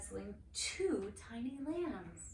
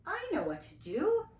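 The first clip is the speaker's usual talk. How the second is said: much higher, louder eager voice; excited; 11 semitones higher, 6 dB louder than usual. Acted. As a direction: announce the plan with glee. Excited idea.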